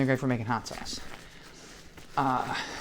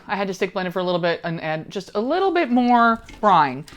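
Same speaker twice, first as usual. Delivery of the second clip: ordinary talk, nothing marked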